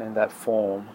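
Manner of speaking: a quick flash of Australian vowels; Australian accent. Shown as a demonstration. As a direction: drop into an Aussie accent on "In that form". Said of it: "Did you catch my Australian accent there".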